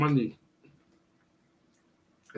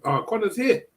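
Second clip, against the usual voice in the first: excited; 9 semitones higher, 3 dB louder than usual. Real, not acted. Fighter arrives, excited call.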